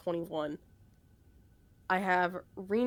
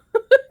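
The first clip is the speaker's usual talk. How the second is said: loud laugh; 17 dB louder than usual. Real, not acted. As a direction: burst of high laughter. Laughs mid quote.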